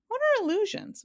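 high curious question; high wondering boy. Acted. Milo wonders.

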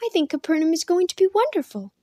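lightly voiced girl, hopeful and insistent; protesting girl. A narrator's reading. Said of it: "she protested".

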